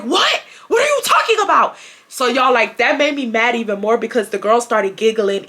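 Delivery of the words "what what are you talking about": shouted retort, much higher; defiant retort. Retold or imitated. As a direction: snap back at him in disbelief. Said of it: "And I'm like".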